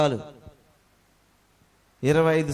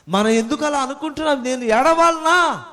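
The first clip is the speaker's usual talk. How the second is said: loud rebuke; 7 semitones higher, 6 dB louder than usual. Acted. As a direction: challenge the congregation hard. Preacher rebuking congregation loudly. (సంఘాన్ని నిలదీస్తూ గట్టిగా, నెమ్మదిగా)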